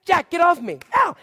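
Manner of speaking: loud, high mock cries of being hit; hammed-up fake protest, shouted; sister faking pain. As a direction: imitate a sibling yelping for a parent to hear. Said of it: "slap herself and say".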